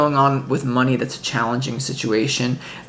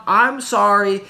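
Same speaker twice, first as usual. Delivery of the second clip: ordinary talk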